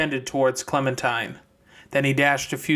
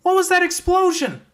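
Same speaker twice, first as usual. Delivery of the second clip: loud high shouted question; shouting question; 5 dB louder than usual. Acted. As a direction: yell while running. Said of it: "Clementine shouted".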